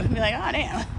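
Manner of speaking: usual speaking voice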